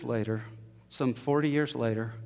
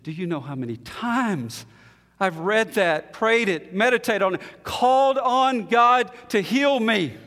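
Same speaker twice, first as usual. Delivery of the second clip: overwhelmed outcry; 8 semitones higher, 6 dB louder than usual. Retold or imitated. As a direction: let it swell to an anguished cry. Woman overwhelmed at the pool.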